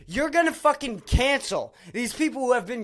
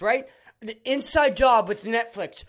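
ordinary talk

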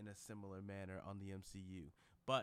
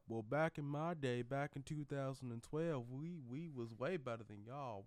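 put-on partial country accent; 3 semitones higher than usual. Old Thor lecturing the new Avengers, half-country accent. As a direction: lean into a country twang like a washed-up veteran hero. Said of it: "I'm using a partially country, country accent".

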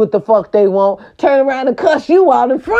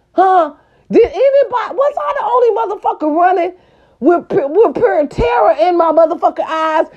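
high-pitched, fast and shouting; high excited rant; 9 semitones higher than usual. Real, not acted. Ranting about curfew terror.

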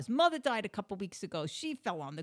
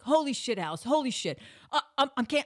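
higher, louder mock panic; panicked husband; 5 semitones higher, 6 dB louder, faster than usual. Husband panicking before the hospital drive, raised flustered voice. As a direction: imitate a flustered husband, stumbling over words. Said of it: "My husband is in this huge panic".

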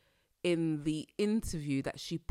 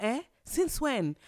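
shocked disbelief; 7 semitones higher, slower than usual. Retold or imitated. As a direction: blurt it out in sheer disbelief. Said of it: "I was like".

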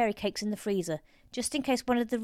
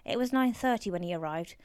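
ordinary delivery, nothing marked

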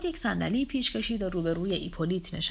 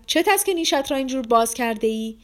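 higher, louder angry cry; shouted outburst; 5 semitones higher, 5 dB louder than usual. A narrator's reading. Shouting at someone grinning at her, outraged yell. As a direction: snap it out, voice raised. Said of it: "خارج فریاد زد".

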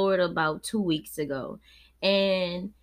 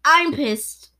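sharp angry burst, higher and louder; pissed off; 7 semitones higher, 8 dB louder than usual. Real, not acted. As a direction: spit out the anger. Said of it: "I'm just saying it".

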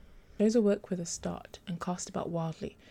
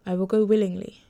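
overly formal; 8 dB louder than usual. A narrator's reading. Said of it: "her voice overly formal".

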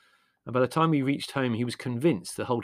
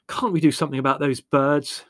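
loud, higher, heated question; exasperated complaint at raised volume; 5 semitones higher, 8 dB louder than usual. A narrator's reading. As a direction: demand action, thoroughly exasperated. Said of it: "he inquired heatedly".

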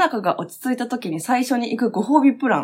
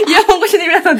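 high, loud laughing call; 10 semitones higher, 7 dB louder, faster than usual. Real, not acted. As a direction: laugh while calling out to listeners. Laughing invitation to listeners.

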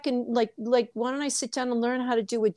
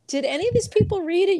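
higher, exasperated disbelief; incredulous mock question; 10 semitones higher, 3 dB louder, faster than usual. Scoffing at recycled social media quotes, incredulous. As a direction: toss it up high in disbelief. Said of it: "I'm like".

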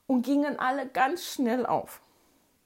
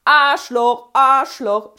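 yelled much louder, each Arschloch falling; bold shout; 3 semitones higher, 13 dB louder, slower than usual. Retold or imitated. Girl keeps yelling insults at the bully. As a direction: keep yelling it bravely. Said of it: "schrie ich mutig weiter".

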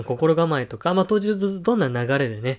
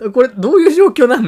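loud, high laughing question; laughing disbelief; 10 semitones higher, 12 dB louder than usual. Real, not acted. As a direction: laugh at the absurdity. Baffled at awkward role-play.

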